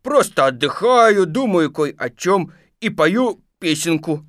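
forced cheerfulness. Acted. Stuck Pooh claiming leisure, with forced cheer. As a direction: lilt along as if perfectly happy. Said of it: "стараясь говорить весёлым голосом".

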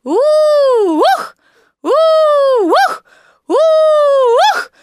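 long high "ooh" ending in a loud jolted "ookh"; bouncing "oo-ookh". A narrator's reading. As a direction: whoop up, thump down, over and over. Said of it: "И ему пришлось повторять". (громкое высокое «у-ух» на каждом прыжке)

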